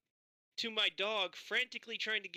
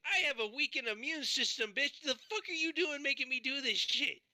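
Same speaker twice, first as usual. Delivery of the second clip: retold angry outburst; 9 semitones higher than usual. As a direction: snap back at the manager with a mock outraged yell. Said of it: "I'm like".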